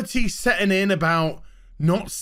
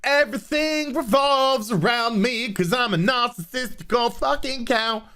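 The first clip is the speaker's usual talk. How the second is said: mocking Mikey; 4 semitones higher than usual. Ranter mocking Mikey Burnham's self-importance, smug mock voice. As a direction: sneer it out like a narcissist bragging. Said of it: "Mikey had to go".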